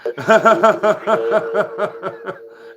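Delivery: long, loud, high laugh; helpless run of giggling laughter; cracking up. Real, not acted. Streamer reading a rude viewer name aloud, cracking up.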